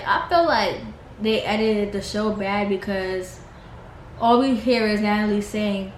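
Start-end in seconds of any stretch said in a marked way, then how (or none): none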